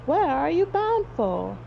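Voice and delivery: high-pitched eager question; exclaiming. A narrator's reading. Rescued hunter questions Barnaby, high exclaiming voice. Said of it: "he exclaimed".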